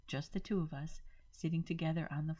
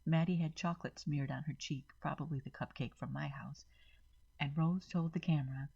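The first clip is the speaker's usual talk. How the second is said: plain reading voice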